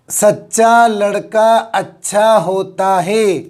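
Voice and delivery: सच्चा लड़का अच्छा होता है read slowly, each word distinct, with सच्चा held on the doubled च; slow model reading. Shown as a demonstration. Teacher reading a sample sentence slowly for students to repeat. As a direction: read it slowly for the class to copy. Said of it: "क्या पढ़ेंगे इसको".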